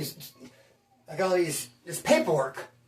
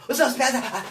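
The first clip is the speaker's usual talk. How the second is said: higher-pitched, panting puppy greeting; mock an over-eager puppy; eager little puppy; 4 semitones higher, slower than usual. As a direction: play it like an excitable little puppy. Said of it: "be like a little puppy".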